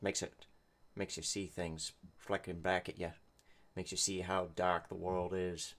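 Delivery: low, brooding noir narration; lightly voiced hard-boiled detective. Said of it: "detective noir voice going off in his head".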